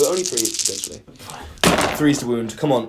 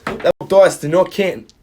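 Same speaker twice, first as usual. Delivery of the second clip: slow, hammed-up bad Australian accent, a bit louder; terrible Australian accent; 5 semitones higher, 6 dB louder, slower than usual. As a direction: fake Aussie accent, badly done on purpose. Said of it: "That was a terrible Australian accent".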